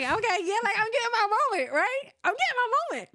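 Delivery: high, fast, giddy inner voice; flattered and thinking this is her moment; giddy retold thrill. Recalling a stranger's approach, giddy with excitement. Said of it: "I'm like".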